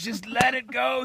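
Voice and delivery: loud, high birth-coach urging; urgent coaching. Acted. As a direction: urge a labouring hen on.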